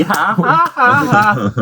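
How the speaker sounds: fake mocking laugh.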